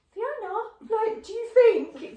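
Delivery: high, loud put-on pestering; mock eager questioning. Mimicking needy fishing for proof.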